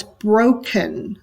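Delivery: "broken" drawn out slowly, weight on "bro"; stress on first syllable. Shown as a demonstration. Teacher demonstrates a trochee, "broken" stressed on "bro". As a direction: lean on the first syllable of "broken", let the second fall. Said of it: "stress on the first syllable".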